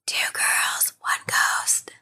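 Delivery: soft eerie whisper of the show title; spooky whisper. Pretend. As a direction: whisper it like a ghost echo.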